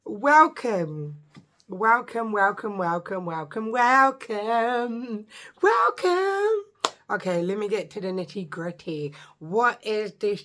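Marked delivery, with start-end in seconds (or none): 0.0-5.3 playful welcome. Acted. 5.6-6.6 much higher, singsong welcome. Acted. Final sung-out welcome.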